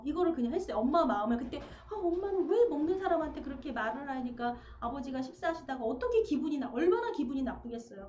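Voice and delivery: ordinary talk, nothing marked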